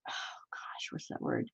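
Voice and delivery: unvoiced whisper, barely audible; very soft private whisper; whispering to self. Real, not acted. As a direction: whisper it to yourself while puzzling over a word. Puzzling out a word mid-reading.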